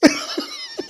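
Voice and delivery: high, loud laugh; real burst of laughter. Real, not acted. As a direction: laugh out at the memory. Laughing at his own story.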